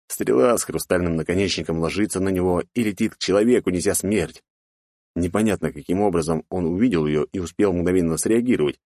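usual speaking voice

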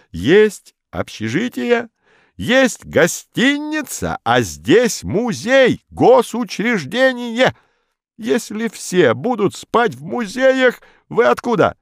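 high, slow lecturing official; pompous bureaucrat. A narrator's reading. Modest lectures.

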